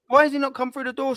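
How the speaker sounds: high indignant question; exasperated. Real, not acted. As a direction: pitch jumps up, exasperated. Fan ranting about no striker.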